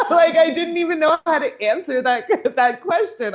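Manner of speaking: can barely get the words out for laughing. Real, not acted. Laughing at the client's question.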